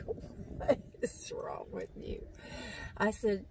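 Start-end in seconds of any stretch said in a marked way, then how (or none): 0.1-2.2 voiceless, hushed imitation of a startled companion; retold question in a breathy whisper; mock whisper. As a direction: whisper it as someone else's reaction. Mimicking husband's reaction to her yelp.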